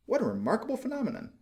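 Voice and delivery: high, marvelling scholarly exclamation; a learned man astonished at what he sees; amazed professor. Acted. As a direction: pitch up in pompous wonder. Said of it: "said the professor of ornithology".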